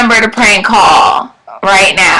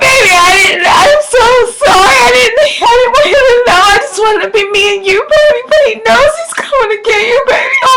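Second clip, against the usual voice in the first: put-on crying girlfriend; 11 semitones higher than usual. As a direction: wail and beg in panic. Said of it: "I'm gonna do a prank voice message".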